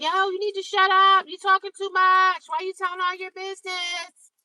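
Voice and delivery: high mocking voice of the haters; mocking critics. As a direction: squeal it like a nagging critic. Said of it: "Some people be like".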